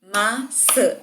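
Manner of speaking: MAÇÃ split in two beats, "Ma - çã"; dividido em sílabas. Shown as a demonstration. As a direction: say Maçã syllable by syllable, pausing between. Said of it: "qual palavra é maior". (Maçã separado em sílabas, ma... çã)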